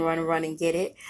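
ordinary delivery

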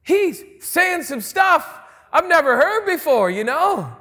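high, loud amazed outburst; amazed shout. Retold or imitated. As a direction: burst out as stunned guards. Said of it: "They're like".

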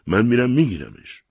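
loud, defiant father's cry; choked shout. A narrator's reading. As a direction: shout it out as if choking with rage.

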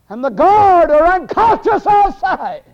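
very high, slow preacher's shout; full-force preaching. Real, not acted. Preacher shouting the news about the fallen guard, full-force preaching. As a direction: holler it out, stretching the words.

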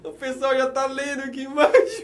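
high voice caught between laugh and tears; overcome, laughing and tearing up; laughing through tears. Real, not acted. Paulo, moved by the message, laughs through tears. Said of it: "Tá chorando pra mano".

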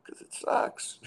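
soft, breathless aside; whispered reply. Retold or imitated. Answering the dog about the show, a soft whispered aside. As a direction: mutter it under your breath. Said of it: "I'm like".

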